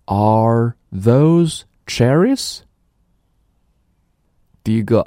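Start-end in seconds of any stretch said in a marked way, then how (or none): 0.1-2.6 very slow rising question for a listening test; slow dictation question. Shown as a demonstration. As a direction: read it slowly so pupils can pick out "cherries". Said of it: "我来读，然后你告诉我". (极慢的听力示范问句)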